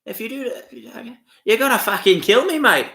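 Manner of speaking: mock Aussie film-quote impression; Chopper. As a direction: put on an Aussie accent, swelling into the line. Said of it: "He's in the jail and he gets stabbed, chopper".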